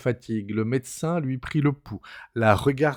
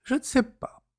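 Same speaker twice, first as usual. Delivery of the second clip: higher woman's voice, lightly murmured; murmured cousine; 13 semitones higher than usual. A narrator's reading. Cousine admitting she does not know, murmured. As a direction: let it slip out helplessly. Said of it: "puis murmura".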